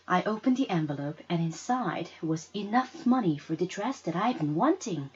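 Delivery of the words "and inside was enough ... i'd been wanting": usual speaking voice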